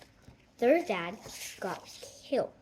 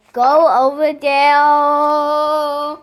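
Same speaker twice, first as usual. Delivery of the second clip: loud, drawn-out order, higher at the end; shouted command; 3 semitones higher, 15 dB louder, much slower than usual. Real, not acted. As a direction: holler it, stretching the words long. Shouting orders at Mister Bean.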